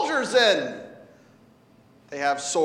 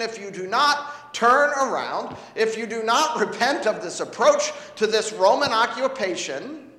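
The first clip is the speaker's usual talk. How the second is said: ordinary talk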